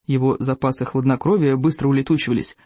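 plain reading voice

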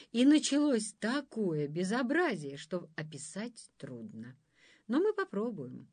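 ordinary delivery

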